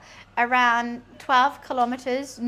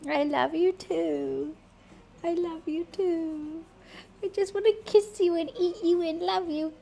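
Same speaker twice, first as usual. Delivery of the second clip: slow, doting made-up pet voice; voicing the pet; 5 semitones higher than usual. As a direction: speak for the cat, all adoring. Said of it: "he just goes".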